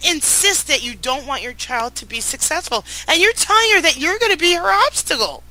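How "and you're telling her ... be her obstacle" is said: indignant. Real, not acted. Outraged at husband.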